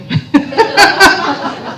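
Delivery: high burst of giggles; laughing. Real, not acted. Speaker amused by her own aside, real laughter.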